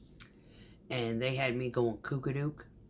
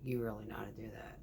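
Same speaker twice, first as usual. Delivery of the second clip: softer, quick hushed question; whispered question; 5 semitones lower, 11 dB quieter, faster than usual. Retold or imitated. The contractor asking if she can really do that, whispered. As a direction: ask it under the breath in disbelief.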